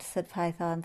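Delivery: usual speaking voice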